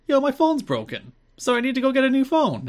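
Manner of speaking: high mocking valley-girl Goldilocks voice. Cohost retelling the segment, mocking Goldilocks in a high voice.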